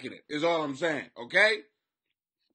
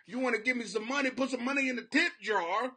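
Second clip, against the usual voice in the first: ordinary talk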